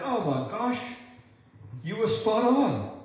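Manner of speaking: high-pitched excited feedback; amazed confirmation. Acted. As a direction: act out delighted amazement, drawing out the praise. Acted delighted feedback to prophet.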